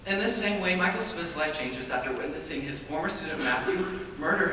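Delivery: measured dramatic announcer read; solemn voice-over for a drama; trailer voice-over. Acted. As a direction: narrate it like a film trailer.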